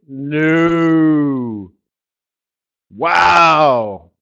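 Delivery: loud drawn-out no, then wow; stretched mock shock; mock astonishment. Real, not acted.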